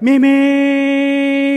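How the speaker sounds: high, loud held honk imitating a car horn; long loud horn honk. Driver imitating the taxi horn to the congregation, loud and held. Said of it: "It was like".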